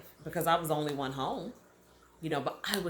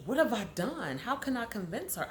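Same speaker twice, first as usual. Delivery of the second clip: pleading with God; 5 semitones higher than usual. Retold or imitated. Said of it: "I began to question God and say".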